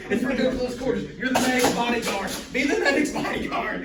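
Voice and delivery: loud, urgent commands; shouting orders. Real, not acted. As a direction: call it across the field.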